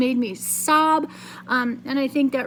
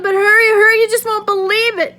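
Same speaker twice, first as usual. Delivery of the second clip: high, loud, eager urging; excited shout; 9 semitones higher, 9 dB louder than usual. Acted. Billy hurrying his mother uphill, loud excited shout. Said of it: "I shouted".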